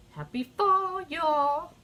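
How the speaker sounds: high, bubbly fall greeting; excited cheer. Real, not acted. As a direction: sing it out to the viewers, thrilled. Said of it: "I get excited".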